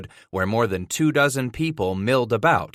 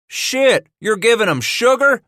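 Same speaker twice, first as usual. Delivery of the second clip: high, loud outraged shriek; 12 semitones higher, 7 dB louder than usual. Acted. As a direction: shriek it in disbelief. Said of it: "the second trapper shrieked".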